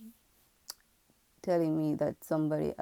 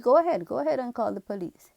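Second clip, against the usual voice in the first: fast, loud, higher defiant dare; defiant retort; 7 semitones higher, 7 dB louder, faster than usual. Retold or imitated. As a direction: dare her to do it, quick and loud. Defiant reply to threatening neighbour.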